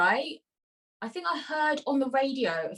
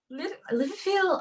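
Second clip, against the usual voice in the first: tentative go at Scouse, pitched up; Liverpool accent; 6 semitones higher than usual. Shown as a demonstration. Host tries the accent her guest asked for, higher-pitched attempted Scouse. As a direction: have a shaky-confidence stab at a Liverpool accent. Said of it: "Um, Liverpool?".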